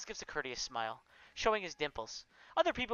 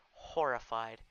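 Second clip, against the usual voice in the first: plain reading voice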